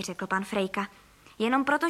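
ordinary delivery, nothing marked

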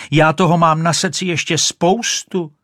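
high, loud insistent plea; storybook shriek of protest. A narrator's reading. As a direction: call urgently after a departing listener. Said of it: "ječela Rachejtle".

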